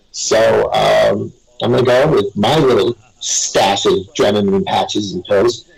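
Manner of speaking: normal talking voice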